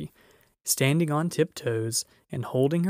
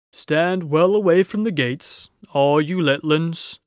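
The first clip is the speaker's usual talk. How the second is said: loud, higher giant warning; booming giant; 4 semitones higher, 7 dB louder than usual. A narrator's reading. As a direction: boom a warning to the crowd. Giant voice in story.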